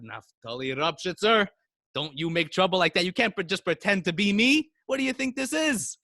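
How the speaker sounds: rising, sharper scolding. Retold or imitated. Rebbe scolding the student for impersonating him, rising.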